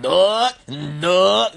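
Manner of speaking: loud, drawn-out monster call; creepy creature. Acted. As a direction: imitate a creepy shadow creature. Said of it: "this creepy fucking thing".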